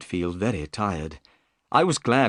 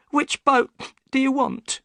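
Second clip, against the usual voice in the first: high, worried woman's voice; the boatman's anxious wife; high worried voice of Mrs Smith; upset wife; 3 dB louder than usual. Acted.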